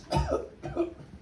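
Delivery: unvoiced squeaky cough; the Lorax coughing from the smog; breathy high cough. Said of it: "please pardon my cough".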